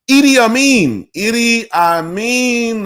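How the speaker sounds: name bursting out high and loud; excited recall. Real, not acted. Speaker suddenly remembers who the actor played, excited high exclamation. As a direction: blurt it high, then stretch the repeat.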